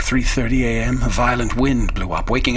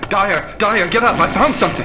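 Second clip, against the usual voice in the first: excited calling out; 7 semitones higher than usual. Acted. Peasley rousing Dyer in the night, urgent excited calls. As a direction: rouse him with urgent excitement.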